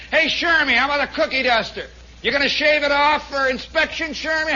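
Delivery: high, loud barracks ribbing; teasing heckler. Acted. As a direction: call out a jeer at your buddy. Barracks guys teasing Shermy, acted.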